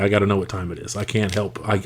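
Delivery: normal talking voice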